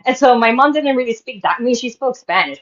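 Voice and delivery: ordinary talk, nothing marked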